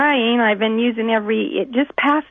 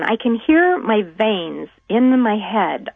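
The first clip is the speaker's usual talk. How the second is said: ordinary talk, nothing marked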